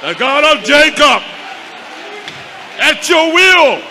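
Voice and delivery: loud, high preaching cry, invoking God; shouted prayer. Real, not acted. Preacher reenacts his prayer on the floor, shouting. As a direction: call on the God of Abraham at full volume.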